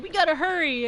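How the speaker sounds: high, loud imitation of a hurried teammate; mock urgency. Mimics teammates urging hurry.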